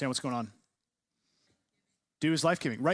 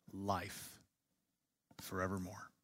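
"life, forevermore" said low and much softer, each word set apart; hushed emphasis; 6 semitones lower, 12 dB quieter, slower than usual. Shown as a demonstration. Teacher reading the poem's closing words to the class, low and hushed. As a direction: drop the voice on "life" and "forevermore" like a full stop. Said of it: "acts as kind of a verbal period".

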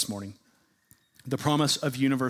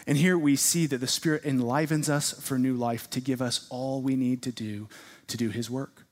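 ordinary talk

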